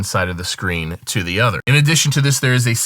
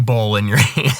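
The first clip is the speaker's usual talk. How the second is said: laughing. Real, not acted. Giggling over the ball joke.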